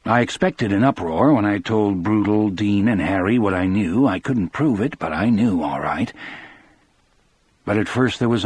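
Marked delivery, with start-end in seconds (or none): none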